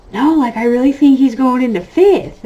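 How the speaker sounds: insistent. Retold or imitated. Wife insisting to Ricky that son's in fifth. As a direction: insist like you're sure you're right. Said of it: "And I was like".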